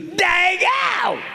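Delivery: very high shrieked impression of a woman; meth-head woman. Woman in line turns around to him, high shriek. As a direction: screech it in a falsetto yell. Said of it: "She goes".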